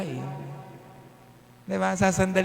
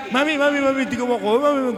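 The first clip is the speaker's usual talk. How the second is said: put-on higher, insistent child calling for attention; child calling mommy; 7 semitones higher, much faster than usual. Preacher imitating a child begging mommy for attention. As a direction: whine for mommy to look. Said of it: "Gusto niya sa lagi".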